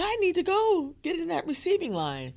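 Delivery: high-pitched, excited self-quote; urgent resolve. Retold or imitated. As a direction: pitch it way up, suddenly determined. Retelling urgent resolve to join line.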